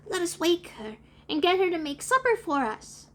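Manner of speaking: put-on high, eager little-boy voice; put-on small-boy voice, pitch up; Michael. A narrator's reading.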